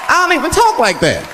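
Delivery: high, put-on social voice; stuck in a phony high register; fake voice. Caught faking under social pressure, high put-on voice. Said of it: "they make you fake".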